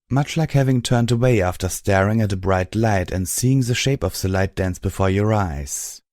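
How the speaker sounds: plain reading voice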